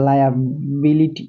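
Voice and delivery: Liability drawn out very slowly, each syllable clear; slow demo. Shown as a demonstration. Teacher showing students the word, very slowly. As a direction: stretch liability slowly for learners. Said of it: "দেখছো?".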